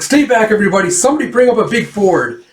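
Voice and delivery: rally everyone under attack; urgent acted crowd command; shouted orders. Villager orders crowd under arrow fire.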